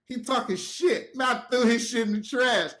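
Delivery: high, heated inner rant; worked-up retold grievance; mad. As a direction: stew out loud, getting worse. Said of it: "'cause I'm mad".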